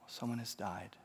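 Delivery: hushed, grieving grandmother, lightly voiced; understated grief. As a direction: break the news softly, with restrained sorrow. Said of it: "and just sobs and sobs".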